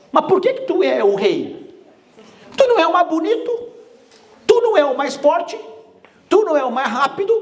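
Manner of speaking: high, mocking, laughing challenge to the king; questioning animals. Forest animals challenge the lion's crown, high and mocking. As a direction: pitch it way up and tease the lion.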